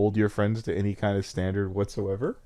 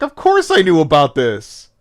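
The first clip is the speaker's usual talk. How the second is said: mock loud admission; 5 semitones higher, 9 dB louder than usual. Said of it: "you're like".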